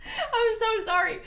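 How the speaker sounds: high, slow, squeaky apology; laughing apology. Real, not acted. Streamer apologising for screaming in headphones, squeaky laughing apology.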